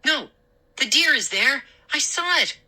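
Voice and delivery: high, urgent denial; alarmed protest. Acted. Boy alarmed, contradicting father, urgent and high. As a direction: climb in pitch as you insist.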